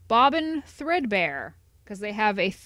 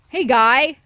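loud call; 3 semitones higher, 9 dB louder than usual. Real, not acted. Player calling after the map seller, loud.

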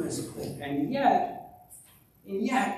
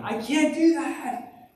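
high, slow mock wail; hammed-up toddler meltdown; imitating a wailing child; 11 semitones higher, slower than usual. Preacher imitates a toddler refusing to say sorry, mock wailing. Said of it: "throwing themselves on the ground crying".

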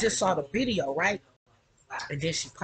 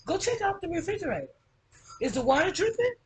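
high-pitched call across the room; higher-pitched off-camera instruction; 10 semitones higher than usual. Real, not acted. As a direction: send someone to check, voice raised in pitch. Calls instructions to someone off camera.

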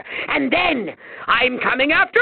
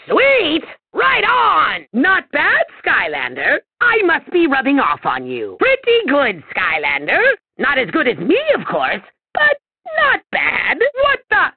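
ordinary delivery

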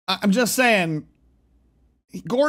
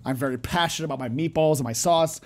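lower, softer, composed apology; calm apology; 6 semitones lower, 4 dB quieter than usual. Pretend. Streamer models what the owner should say, calm apology. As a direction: lower your voice and apologise evenly. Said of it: "just go breathe and be like".

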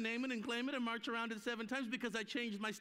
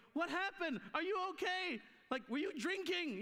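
higher, urgent worried questions; alarmed questions; 8 semitones higher than usual. Retold or imitated. Husband finding his wife rolling with laughter, alarmed. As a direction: ask as if something is badly wrong.

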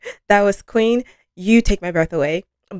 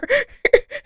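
high squealing laugh. Real, not acted. Laughing at short song.

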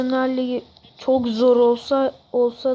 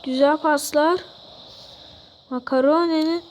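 normal talking voice

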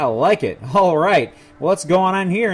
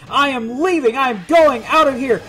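emphatic shout; 5 semitones higher, 6 dB louder than usual. Real, not acted. Finds secret exit, flees.

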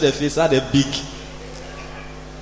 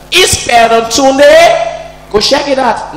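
commandant calling; 6 semitones higher, 3 dB louder, much slower than usual. Retold or imitated.

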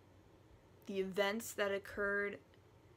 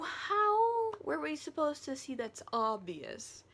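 loud, drawn-out mock outrage; exasperated disbelief; 5 semitones higher, 5 dB louder, slower than usual. Reader protesting an unguessable plot twist, exasperated. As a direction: pitch up in baffled, sputtering protest. Exasperated at the plot's supposed clue.

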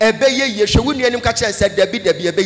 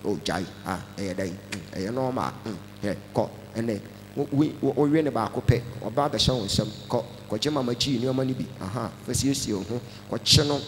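very low, soft devilish voice; deep, hushed voice of the devil; Satan's voice; 11 semitones lower, 10 dB quieter than usual. Acted. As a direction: croon temptations like a sly demon. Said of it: "Wesi na voice foforɔ aba".

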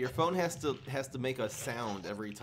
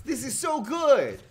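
excited shout; 13 semitones higher, 12 dB louder than usual. Real, not acted. Excited about winning board.

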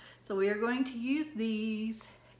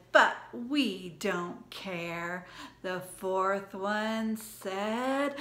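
storytime recitation; 5 dB louder, slower than usual. Acted. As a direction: lively rhyme read to kids. Second recital of rhyme.

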